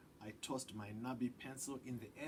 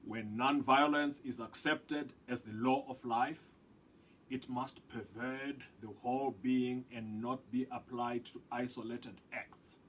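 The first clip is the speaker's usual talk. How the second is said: a firmer, louder elder reciting a principle; grandfather's voice; 9 dB louder than usual. A narrator's reading. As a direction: speak as the grandfather, measured and grave. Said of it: "Pabuji said".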